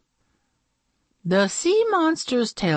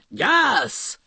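monster voice lifting with joy; happy sea monster; 6 semitones higher than usual. Acted. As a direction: agree like a cheered-up monster. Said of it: "it said".

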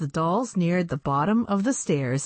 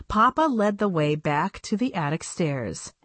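ordinary delivery, nothing marked

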